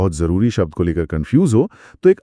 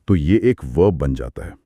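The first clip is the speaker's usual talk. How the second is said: normal talking voice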